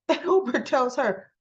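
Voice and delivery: ordinary delivery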